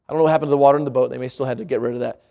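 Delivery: ordinary talk, nothing marked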